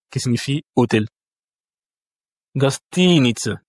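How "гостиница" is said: Gastinitsa slowly, "gas-TEEE-nitsa"; stress on second syllable. Shown as a demonstration. Teacher shows the tonic accent, stress on second syllable. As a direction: lean long on "ti" in Gastinitsa. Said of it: "la deuxième syllabe".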